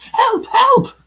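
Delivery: very high, loud squeal for help; panicked Piglet cry. A narrator's reading. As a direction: squeal in terror as a tiny creature. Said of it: "cried Piglet".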